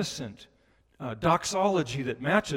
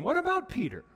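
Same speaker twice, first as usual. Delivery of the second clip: high pitched worried question; urgent question; 10 semitones higher than usual. Retold or imitated. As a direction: call it out with concern.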